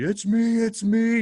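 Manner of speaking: high panicked yell. Retold or imitated.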